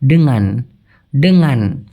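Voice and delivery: teacher modelling ডেঙ্গান with nasal ng; correct pronunciation. Shown as a demonstration. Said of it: "উচ্চারণটা করেন তো please ভাই".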